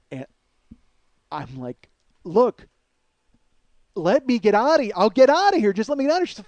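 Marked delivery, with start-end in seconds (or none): 2.2-6.3 loud, high, insistent begging; retold exasperated plea. Man pleading with her to let him get outta here, exasperated. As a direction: re-enact a frantic plea, voice climbing.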